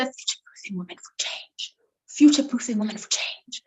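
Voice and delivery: hushed voice of anticipation; soft and fast whisper. Shown as a demonstration. Coach demonstrates the phrase to a participant, whispered. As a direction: whisper it quickly, like water cooler gossip. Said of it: "almost to a whisper".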